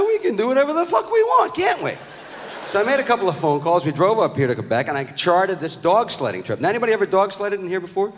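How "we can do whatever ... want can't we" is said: high-pitched, gung-ho outburst; excited dad. Retold or imitated. Dad agreeing to his son's trip, high excited yell. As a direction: let it burst out high, acting overexcited.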